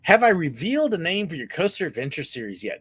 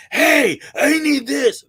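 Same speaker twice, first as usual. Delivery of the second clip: hammed-up brash demand, higher, louder and slow; mock rude American tourist; 10 semitones higher, 8 dB louder, slower than usual. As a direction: play the pushy tourist barking an order. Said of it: "They talk loud".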